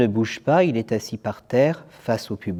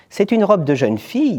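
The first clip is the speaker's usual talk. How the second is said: insistent; 9 semitones higher, 5 dB louder than usual. Acted. Boy repeats the same line.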